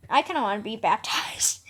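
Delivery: quick secret whisper; hushed, fast confession; whispered aside. Retold or imitated. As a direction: let it slip out under the breath. Private aside about baptism.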